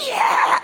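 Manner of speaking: hoarse, voiceless mock scream; half-hearted scream to startle someone; jump-out scare yell, voice not fully there. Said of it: "I can't do it 'cause my voice".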